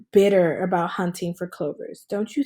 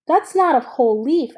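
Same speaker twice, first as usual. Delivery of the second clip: sour; 5 semitones higher, 4 dB louder than usual. Acted. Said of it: "Alan Shipman said sourly".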